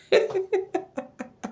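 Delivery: high-pitched giggling laugh. Real, not acted. Laughs before signing off.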